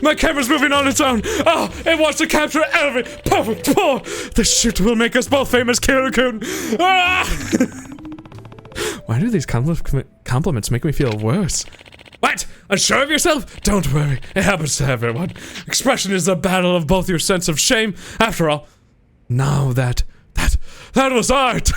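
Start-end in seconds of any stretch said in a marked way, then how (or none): 0.0-9.1 much higher, squealing delight; swooning photographer. Acted. Photographer fawning over the maid pose, higher squealing. 12.2-21.8 lightly voiced, soothing then bursting; calm him then cheer; reassuring photographer. Photographer comforting the shy model, lightly voiced.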